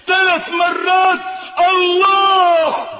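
loud, high shouted climax; preaching climax. Acted. Preacher at the climax of his sermon, shouting. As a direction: shout it out at full force.

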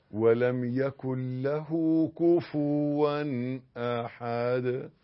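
slow measured chanting; Quran recitation. Shown as a demonstration. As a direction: recite each phrase slowly and evenly.

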